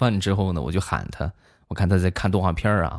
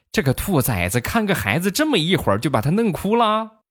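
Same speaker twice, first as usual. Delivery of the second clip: mom yelling; 8 semitones higher than usual. Retold or imitated. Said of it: "我妈声嘶力竭骂我".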